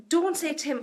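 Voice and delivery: ordinary talk, nothing marked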